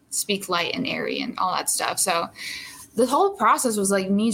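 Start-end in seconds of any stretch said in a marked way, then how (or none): none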